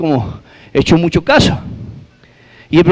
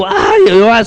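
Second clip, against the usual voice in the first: high, comic crying yell; mock wail; 6 semitones higher than usual. Jilted girlfriend bursts out crying, mock wail. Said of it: "se puso a llorar a los gritos".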